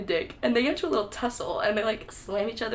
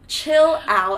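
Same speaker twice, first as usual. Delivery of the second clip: loud mock scold; exasperated loud yell at a fictional teen; chill out shout; 7 semitones higher, 10 dB louder than usual. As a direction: tell him to chill, loud and high.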